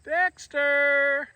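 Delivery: high, carrying call; calling the cat. Real, not acted. Owner calling Dexter across the garden, high call. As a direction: call the name out high and clear.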